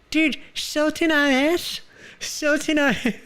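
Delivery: very high, slow put-on voice; high silly voice. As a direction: drawl it out in a silly squeak.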